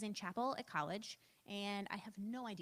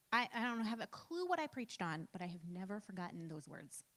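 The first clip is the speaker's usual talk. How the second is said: ordinary talk, nothing marked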